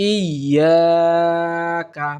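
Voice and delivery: "ইয়াকা" very slow, long "yaa", quick short "ka"; correct tajweed. Shown as a demonstration. Teacher modelling the word, careful tajweed. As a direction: stretch "iyyaa", clip the "ka" in "ইয়াকা". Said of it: "তাড়াতাড়ি আমরা পড়ার চেষ্টা করব".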